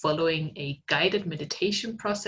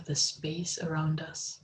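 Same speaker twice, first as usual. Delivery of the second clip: guided meditation; 3 dB louder than usual. Acted. Meditation guide leading students in art therapy, calm and unhurried. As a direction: ease listeners into stillness, unhurried.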